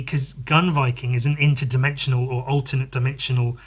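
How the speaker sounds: ordinary talk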